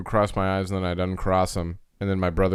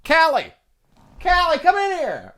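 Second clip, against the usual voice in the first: much higher call to another room; 4 dB louder than usual. Retold or imitated. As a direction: call her in from down the hall. Brother calling sister in.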